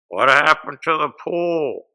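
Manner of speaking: loud, slow heckle from the audience; heckling shout. Retold or imitated. Comic heckles the union boss, slow loud heckle. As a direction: call it out slowly to the stage. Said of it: "He looks at me and he goes".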